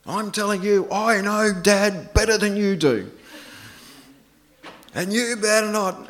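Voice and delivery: put-on loud, high, insistent kid bickering; arguing child. Father mimics his daughter arguing with her sister, bossy child voice. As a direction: imitate a child squabbling, slow and emphatic. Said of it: "one was saying".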